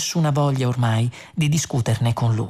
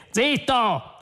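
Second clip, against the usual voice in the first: sharp order; 10 semitones higher than usual. A narrator's reading. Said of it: "ordinò Zaphod".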